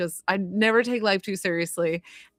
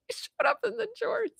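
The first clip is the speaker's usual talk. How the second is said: words squeezed through real laughter, much higher; laughing; 8 dB quieter than usual. Real, not acted. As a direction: giggle it out in delight. Laughing at spirit's jorts.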